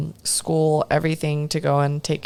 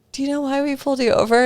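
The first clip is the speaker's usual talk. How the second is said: higher, fast cop question at the car window; lightly voiced police officer; imitating a cop; 9 semitones higher, 4 dB louder, much faster than usual. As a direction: rattle it off like a routine traffic stop. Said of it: "they're like".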